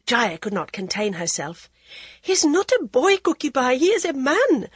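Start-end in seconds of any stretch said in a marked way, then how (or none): 2.2-4.7 high, loud indignant protest; outburst. A narrator's reading. Jaya snaps at the old concubine, high indignant outburst. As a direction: Jaya blurting out her objection. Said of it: "at Jaya's outburst".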